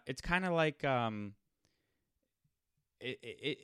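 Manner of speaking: ordinary talk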